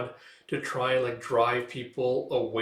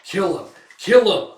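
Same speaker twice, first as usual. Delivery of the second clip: louder with each command; angry orders building to a roar; 7 semitones higher, 9 dB louder than usual. Retold or imitated. Commander ordering guards to kill Guts, rising angry shout. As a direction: whip the guards into a frenzy. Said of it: "It's like".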